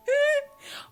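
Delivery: loud, high cackle of laughter; burst of high loud laughter. Real, not acted. Streamer bursting out laughing at a viewer's silly guess, loud high laugh.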